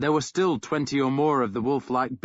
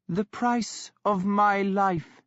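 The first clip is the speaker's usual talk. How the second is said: slow, higher, eerily calm; 8 semitones higher, slower than usual. A narrator's reading. Sister calmly pronouncing her brother's doom. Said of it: "in a calm voice".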